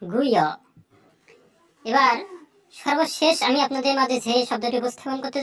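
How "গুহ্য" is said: গুহ্য split into "gu" and "hyo"; Sanskrit pronunciation. Shown as a demonstration. Teacher shows the Sanskrit sound, গুহ্য as "gu-hyo". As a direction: give গুহ্য an h-y glide, no j. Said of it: "গুজ্জটার উচ্চারণ হচ্ছে".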